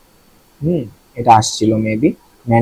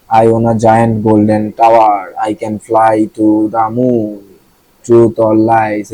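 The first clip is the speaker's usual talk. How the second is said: slow example read; much slower than usual. Shown as a demonstration. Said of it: "reading example sentence slowly".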